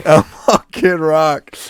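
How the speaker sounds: loud, slow announcement; slow, booming repeat; drawn-out hype. Acted. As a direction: stretch it out loud like a hype man. Echoing the mash-up with delight.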